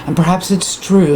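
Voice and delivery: normal talking voice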